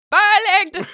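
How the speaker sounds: bad Jamaican accent. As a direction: blurt a terrible attempt at Jamaican. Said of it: "told to do Jamaican and she just goes".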